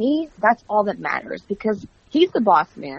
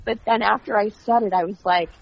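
ordinary talk, nothing marked

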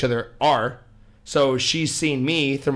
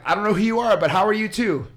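sarcastic; 8 semitones higher, 3 dB louder, faster than usual. Retold or imitated. His mock reply.

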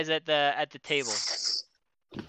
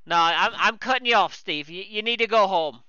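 usual speaking voice

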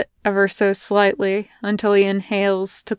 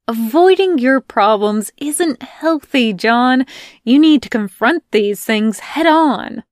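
louder, pushy lecture; lecturing Melanie; 4 semitones higher, 5 dB louder than usual. Acted. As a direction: lay down the law to John.

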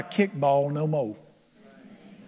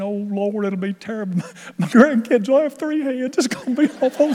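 mock fretful prayer; 4 semitones higher than usual. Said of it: "I wasn't saying".

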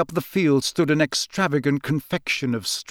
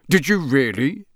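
a touch nervous, rising question. A narrator's reading. Mister Weasley to the suspicious keeper, mildly nervous. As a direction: feign polite interest, slightly uneasy. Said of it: "said Mister Weasley nervously".

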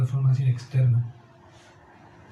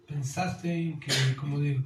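ordinary talk

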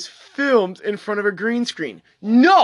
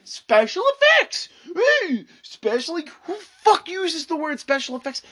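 high exasperated rant, raised voice; mock exasperation; 8 semitones higher than usual. Ranting to viewers about the article, exasperated mock outburst. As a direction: squeal the phrase in disbelief. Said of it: "I'm like".

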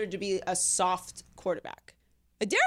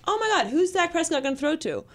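higher, mildly exaggerated worry; mock dismay; 6 semitones higher than usual. Host recalling fans at the start of the season, mock dismay. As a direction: voice a fan's overdone concern. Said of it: "we were like".

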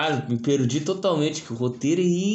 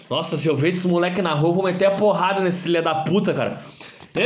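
usual speaking voice

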